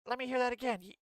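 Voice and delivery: hammed-up simpering complaint; mock whiny defender. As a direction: parody a pleading scold. Mocks defenders of governor.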